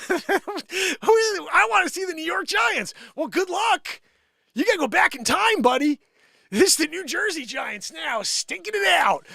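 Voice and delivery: loud, high, brash fan voice; mock New York fan. Mocking Giants fan as New Yorker.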